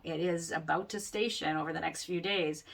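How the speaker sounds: normal talking voice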